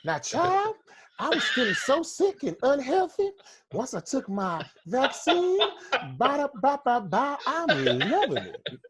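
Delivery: hammed-up church-lady sell, high and slowing; Southern chicken-lady ad voice. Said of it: "He said".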